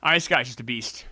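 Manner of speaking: ordinary talk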